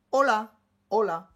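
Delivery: hola said with no H at all, starting on the o; don't breathe the H in hola; hola, silent H, Spanish style. Shown as a demonstration. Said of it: "mais tu ne le prononces pas".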